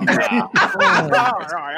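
laughing. Real, not acted. Laughs at a teasing answer.

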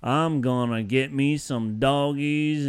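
slow mock Southern drawl; typical Southern accent. Shown as a demonstration. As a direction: imitate a stock Southern accent, drawn out. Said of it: "like, Southern accent".

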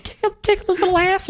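squeaky high silly voice. Retold or imitated. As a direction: say it in a tiny high voice. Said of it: "she was like".

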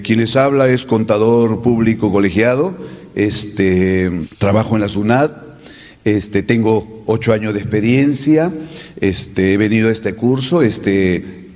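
low, halting speech full of "este"; imitating a hesitant accountant. Profesor imita a un contador que repite "este". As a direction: drag out each "este" like a nervous speaker. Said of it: "Como aquel contador que decía".

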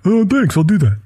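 imitated friend, starting high; Brian Cooper. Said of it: "It's my Brian Cooper impression".

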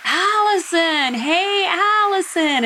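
high, drawn-out call across the wind; mock shout of her name through the storm. Guide calling her name in storm.

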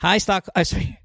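ordinary talk, nothing marked

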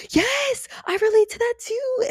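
high, thrilled cry of recognition; excited. Acted. You, acting out relating to a story, excitedly. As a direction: squeal it like you've just found your people. Acted delighted reaction to relating.

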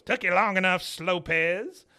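high, loud put-upon snap; hammed-up grumpy old man; Cotton impatient. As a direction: scold the latecomer. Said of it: "Cotton looks at him and goes".

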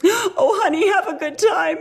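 high-pitched, tearful send-off; tearful mother. Retold or imitated. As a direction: imitate a mother bravely holding back tears. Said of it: "her last tearful words were like".

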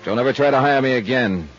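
brisk sign-off over music; expense report readout. Acted. Detective closing his report, brisk and dry. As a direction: read the report, dry and crisp.

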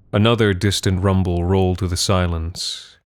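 ordinary delivery

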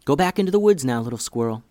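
usual speaking voice

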